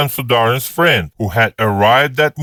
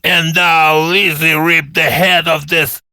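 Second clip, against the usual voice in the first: lightly voiced tipsy bragging, higher and slow; boastful drunk colonel; 5 semitones higher than usual. Colonel announcing his party trick, slow and boastful. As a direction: show off with slow tipsy bravado.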